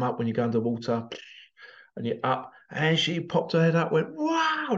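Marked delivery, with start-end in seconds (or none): 4.1-4.8 high, bright mock-surprised gasp of wonder; a child's amazed wow after her first jump; delighted wow. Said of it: "face of pure delight".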